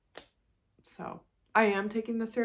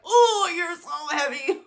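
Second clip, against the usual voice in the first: put-on straining at baby; 10 semitones higher, 8 dB louder, slower than usual. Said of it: "I was like".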